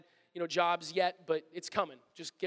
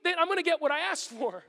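higher, urgent plea; pleading inner wish; 9 semitones higher, 6 dB louder than usual. Retold or imitated. Employee silently begging to get the day off, urgent. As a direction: beg from the heart to get it. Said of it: "in your heart, you're like".

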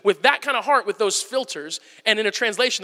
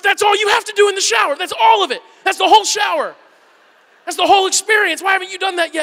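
high, loud, incredulous rant; exasperated scolding; 9 semitones higher, 5 dB louder than usual. Retold or imitated. Dad to his dawdling son in the shower, exasperated. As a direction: scold in mounting disbelief.

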